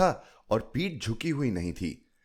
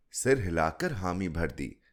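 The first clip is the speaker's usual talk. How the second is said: ordinary delivery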